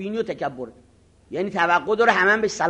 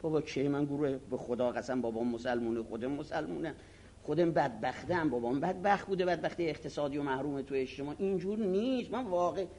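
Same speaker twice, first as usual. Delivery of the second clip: much softer, pleading his case; quiet pleading; 5 semitones lower, 12 dB quieter than usual. Retold or imitated. Accused student begging the cleric to believe him, soft and pleading. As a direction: plead softly as the wronged.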